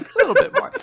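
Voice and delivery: giggly plea. Acted. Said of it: "she's just like".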